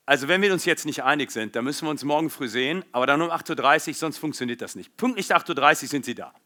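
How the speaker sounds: fast, rigid, clipped German delivery. Shown as a demonstration. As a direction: play the strict, punctual German. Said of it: "I would probably be talking a little bit like this".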